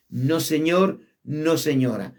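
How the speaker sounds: normal talking voice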